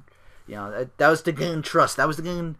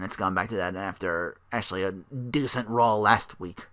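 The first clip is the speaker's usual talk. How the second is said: usual speaking voice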